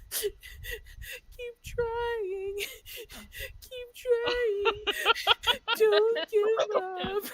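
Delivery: put-on high sobbing little-kid voice, breaking into sobs between phrases; small child sobbing and pleading to herself, high and loud by the end; imitating sobbing child. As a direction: mimic a crying kid cheering herself on. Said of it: "sobbing at this point".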